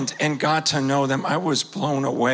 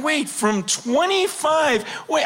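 higher, slow, a half-shouted double take; startled realisation; 10 semitones higher than usual. Retold or imitated. Realising at fifty he'd be retired from service, startled half-shout. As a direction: catch yourself mid-thought in disbelief.

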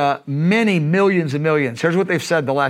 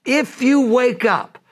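even slower, higher repeat of the plea; high pleading call; 8 semitones higher, slower than usual. Retold or imitated. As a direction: press the appeal once more. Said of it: "he goes".

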